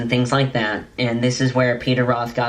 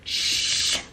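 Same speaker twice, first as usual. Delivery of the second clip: whispered shush, no voice at all; a mother's whisper to keep it down; 5 dB quieter than usual. Retold or imitated. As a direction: hush someone with a breathy shh. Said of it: "she's kind of like".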